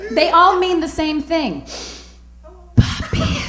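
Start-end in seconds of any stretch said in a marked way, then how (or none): 2.7-3.4 high breathy purred Papi; sultry papi. Pretend. Comic savoring a Latin pet name, sultry and high. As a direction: purr it out like a seduction.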